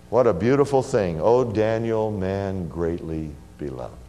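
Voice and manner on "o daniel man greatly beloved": soft, slow and low, full of love; tender. Acted. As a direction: speak it gently like a loving whisper of Christ. Christ's tender words to Daniel.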